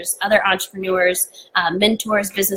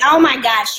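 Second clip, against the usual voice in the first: louder, higher delighted reply; excited acceptance; 6 semitones higher, 6 dB louder, slower than usual. Retold or imitated. Baker accepting a panel invitation, excited. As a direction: blurt it out, thrilled to be asked.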